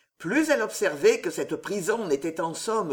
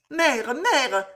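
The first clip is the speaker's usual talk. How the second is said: crying out; 7 semitones higher, 4 dB louder than usual. A narrator's reading. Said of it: "s'écria Loïse".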